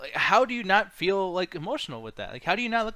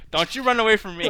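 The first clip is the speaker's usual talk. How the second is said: ordinary talk, nothing marked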